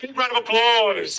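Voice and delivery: slow, high, rallying call to the crowd; call for applause. Real, not acted. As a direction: rouse the audience for a big cheer. Host calls for applause.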